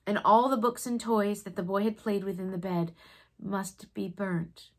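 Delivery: plain reading voice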